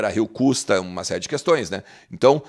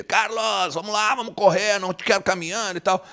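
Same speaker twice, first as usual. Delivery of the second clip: high-pitched mock yell, little extra volume; imitated yelling; 8 semitones higher than usual. Coach urging Carlos De Pena to run, imitated yelling. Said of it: "gritou com ele".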